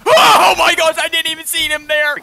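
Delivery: high, loud surprised yell; startled shout. Real, not acted. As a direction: blurt it out in shock. Surprised by enemy.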